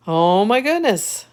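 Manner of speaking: loud, high amazed gasp of words; surprised. Real, not acted. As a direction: marvel at it out loud. Toaster shooting toast aside.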